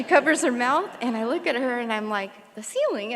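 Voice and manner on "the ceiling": incredulous. Retold or imitated. Asking back in disbelief.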